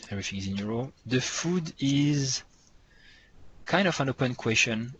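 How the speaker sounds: usual speaking voice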